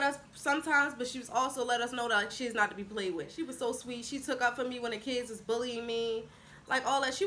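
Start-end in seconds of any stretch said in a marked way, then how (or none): none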